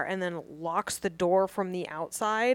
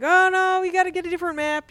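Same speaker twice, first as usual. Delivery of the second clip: higher and louder, rushed and fast; panicked excuse; 9 semitones higher, 7 dB louder than usual. Retold or imitated. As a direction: blurt out the lie in a high-pitched panic. Retold frantic excuse to kidnapper.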